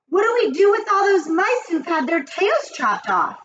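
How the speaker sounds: very high-pitched witch voice; high, excitable query; eager witches' question. A narrator's reading. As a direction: ask like an avid follower. Witch audience questions their leader.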